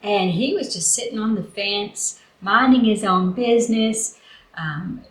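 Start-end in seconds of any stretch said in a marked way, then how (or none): none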